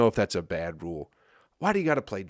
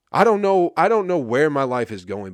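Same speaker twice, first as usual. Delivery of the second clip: higher and louder, throwing up his hands; exasperated outburst; 5 semitones higher, 8 dB louder than usual. Real, not acted. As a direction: blurt it out, fed up with the week.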